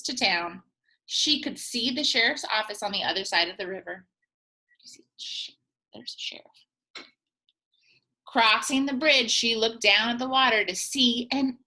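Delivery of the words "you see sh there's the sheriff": whisper. Real, not acted. Whispered aside to kids.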